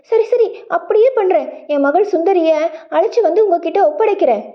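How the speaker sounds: high, rushed, eager-to-escape consent; hurried agreement. A narrator's reading. Merchant desperate to get away from the demon, hurried. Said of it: "அவர் அவசர அவசரமாக".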